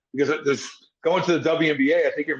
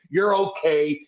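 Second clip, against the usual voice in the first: normal talking voice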